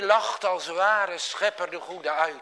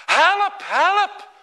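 high, loud panicked cry; cry for help; 12 semitones higher, 8 dB louder than usual. A narrator's reading. Klaas cries out to the skipper, panicked shout. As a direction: scream for help as the wave hits.

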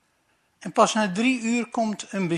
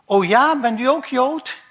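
loud, high and laughing; delighted; 7 semitones higher, 8 dB louder than usual. Acted. Moos cheers at the officer, loud with a laugh. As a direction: burst out happily with a laugh. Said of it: "Moos blij".

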